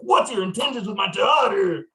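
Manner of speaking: shouting protective father; gruff overprotective dad. Acted. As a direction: bark it like an angry dad. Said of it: "I'm like".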